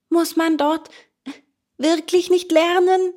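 high, incredulous asking; amazed question. A narrator's reading. Boy about to give in, high amazed question. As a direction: ask in rising wonder. Bengele amazed, asks one last time.

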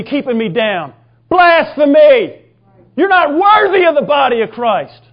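loud, slow, hammering rebuke; retold angry yell. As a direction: bellow God's indignation like a preacher. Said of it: "he yelled at me about blasphemy".

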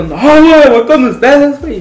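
a milder echo of the frightened whine; mock scared plea. Friend refuses to stay alone, mock frightened plea. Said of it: "todo culón".

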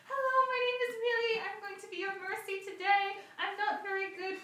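high, sweet-talking girlish put-on voice; high-pitched girlish gamer persona; Millie the Mercy. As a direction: put on a high girly voice with a slight foreign accent. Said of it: "put on a very high-pitched voice".

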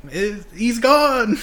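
high, drawn-out mock wail; hammed-up movie scream, stretched long. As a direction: wail it like a melodramatic film heroine. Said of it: "You want Kim screaming".

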